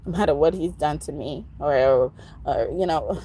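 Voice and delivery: ordinary talk, nothing marked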